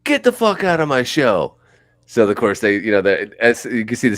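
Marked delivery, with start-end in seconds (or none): 0.0-1.6 imitating a frontman, high and a bit louder; mock angry yell. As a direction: kick the fan out in a rock-star bark. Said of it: "He goes".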